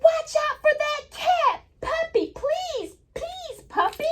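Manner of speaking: storybook reading voice. Acted. Teacher reading the cat page to kids, high sing-song warning. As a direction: call it out like a worried child.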